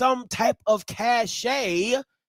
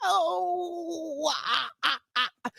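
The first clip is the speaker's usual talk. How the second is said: high laugh fading into soft sighs; helpless laughter winding down; laughing fit; 3 semitones higher, 8 dB quieter, slower than usual. Real, not acted. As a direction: laugh yourself out into "oh, ah, ah". Cracks up, then winds down.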